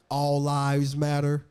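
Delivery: slow, loud deadpan slogan; pointed slogan. Acted. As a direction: drag the words out, pointedly.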